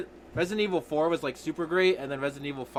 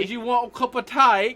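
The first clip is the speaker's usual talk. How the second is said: hammed-up British accent, higher and louder; 10 semitones higher, 8 dB louder than usual. Host turns the podcast British, hammed-up British accent. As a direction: play the posh tea host in mock British. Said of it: "Can this be the British podcast?".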